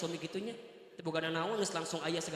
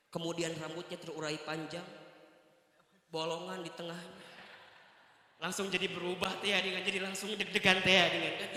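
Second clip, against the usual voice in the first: ordinary delivery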